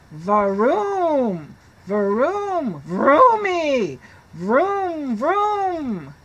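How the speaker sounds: vroom like a kid pretending to drive; bus engine noises. Pigeon pretends to drive, playful engine noises. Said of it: "pretending and making the noises".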